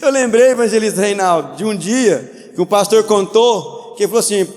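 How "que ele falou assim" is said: usual speaking voice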